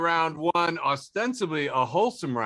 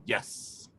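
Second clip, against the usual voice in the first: whispered yes; 5 semitones lower, 7 dB quieter than usual. Retold or imitated. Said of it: "he would go".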